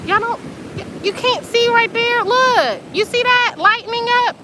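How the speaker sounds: pointing and pleading for them to see it; excited. Real, not acted. Urging viewers to see glow.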